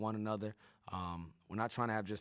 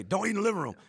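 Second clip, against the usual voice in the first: scolding parent; 10 semitones higher, 8 dB louder, faster than usual. Retold or imitated. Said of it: "what we say".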